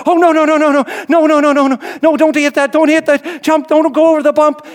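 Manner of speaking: high, loud stream of mock panic; panicked passenger. Boy begging driver to avoid the bump, panicked. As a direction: imitate a kid frantically begging.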